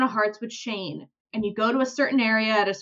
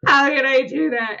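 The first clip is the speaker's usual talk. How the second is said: mock crying; 4 semitones higher, 4 dB louder than usual. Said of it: "He's like".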